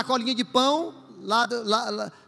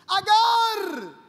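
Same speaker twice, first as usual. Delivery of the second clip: booming call much higher and shouted; God calling from heaven; 11 semitones higher than usual. Acted. God calling Hagar from heaven, booming shout. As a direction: call her name down from the sky like God. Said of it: "Deus falou lá do céu dizendo assim".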